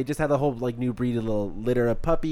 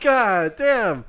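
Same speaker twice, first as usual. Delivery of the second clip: a higher, louder "God damn" of disbelief; stunned; 8 semitones higher, 5 dB louder than usual. Retold or imitated. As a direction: still shocked by the scene. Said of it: "I'm just like".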